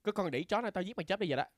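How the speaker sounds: yelling Vietnamese mom voice; impression of mom. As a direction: scold like an angry mother. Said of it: "do an impression of your mom".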